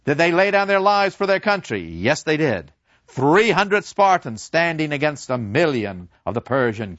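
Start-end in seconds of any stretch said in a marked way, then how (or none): 0.0-1.8 high, louder questioning; rousing professor. Retold or imitated. Professor quizzing his pupils, high and pressing. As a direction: press the class for the answer. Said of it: "Professor Kantorek came in and said".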